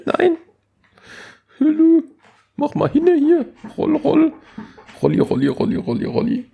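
very high, silly cartoonish squeak; goofy high voice while scooting the chair; squeaky playful voice. Rolling chair closer, playful.

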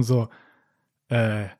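normal talking voice

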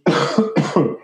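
sudden coughing fit; choking cough. Real, not acted. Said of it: "ich habe mich verschluckt".

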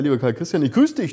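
ordinary delivery

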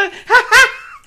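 squeaky high burst of laughter; high giggle. Acted. Rat laughs at his Katastrophentorte, high giggle. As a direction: giggle at your own joke.

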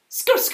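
very high rap skrr ad-lib. Acted. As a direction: squeal it like a rapper's ad-lib. Rap ad-lib after song.

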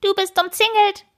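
very high, squeaky little hat voice; talking hat calling out. A narrator's reading. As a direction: pipe up as a tiny talking hat. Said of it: "rief ihr Hut". (piepsig hoher Ruf des Hutes)

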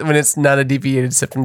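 usual speaking voice

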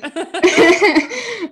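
high bright laugh trailing down. Real, not acted. Guest laughing as she recalls getting fired, high real laugh. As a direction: laugh it off, amused.

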